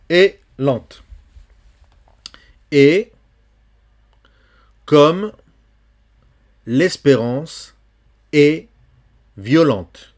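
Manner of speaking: vers lus haut et fort, détachés; poem reading. Shown as a demonstration. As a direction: lire en appuyant chaque mot. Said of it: "lecture du poème". (lecture de poème puissante)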